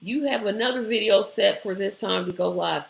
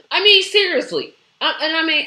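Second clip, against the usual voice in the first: louder and higher, I mean seriously; emphatic outburst; 7 semitones higher, 7 dB louder than usual. Real, not acted. Streamer pressing viewers on compromise, loud outburst. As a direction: push it out, fired up.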